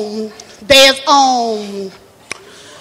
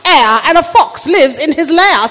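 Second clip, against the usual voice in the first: Lady Catherine; 3 semitones higher than usual. Acted. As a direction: teach it like a grand manor hostess. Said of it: "She said".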